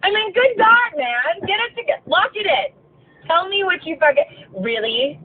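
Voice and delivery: high, exasperated scolding of a liar; exasperated outburst. Retold or imitated. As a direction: scold him in disbelief, pitch way up. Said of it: "at that point, I'm just like".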